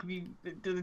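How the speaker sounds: ordinary talk, nothing marked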